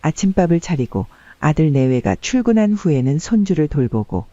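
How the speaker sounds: usual speaking voice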